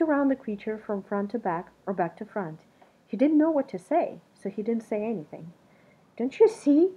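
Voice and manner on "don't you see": high, loud, pleading and eager; urging him to see the likeness; eager cry. A narrator's reading. Creature pleads with Something Else, high and loud. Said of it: "the creature cried".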